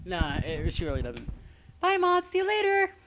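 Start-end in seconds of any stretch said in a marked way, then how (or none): 1.8-2.9 put-on much higher, louder girly voice; perky cartoon girl calling goodbye; character voice. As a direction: chirp it like a perky teen girl. Replaying her character's line.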